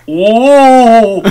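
put-on big German-accented whoa; loud, high German accent exclamation. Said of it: "I've gotta do it in a German accent".

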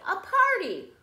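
Cake voice. Acted. Cake answers the assistant.

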